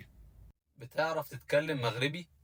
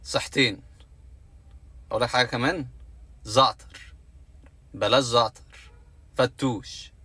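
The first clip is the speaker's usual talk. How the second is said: mock Lebanese accent; 4 dB louder than usual. Shown as a demonstration. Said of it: "اسمع".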